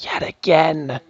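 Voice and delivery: breathy whispered yet again; whispered aside. Real, not acted. Teacher muttering to herself about the repeat question, whispered. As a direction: drop to a sly whisper. Aside while asking the class.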